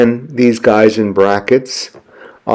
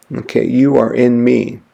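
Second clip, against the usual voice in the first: ordinary talk, nothing marked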